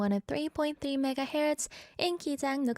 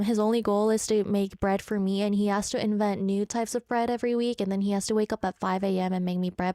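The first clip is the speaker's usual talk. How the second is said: ordinary delivery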